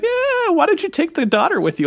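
high, teasing imitation of the wife; lightly hammed-up wife brushing him off; mock wife. Husband imitating his wife suggesting he take the daughter, high.

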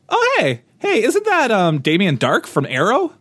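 high, excited "hey, isn't that" voice; surprised recognition. Retold or imitated. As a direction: spot a familiar face, delighted. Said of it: "the cast is just full of".

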